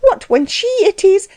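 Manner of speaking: very high, agitated wife; high-pitched scandalized protest; outraged cry. A narrator's reading. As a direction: protest in a high voice until cut off. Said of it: "cried his wife".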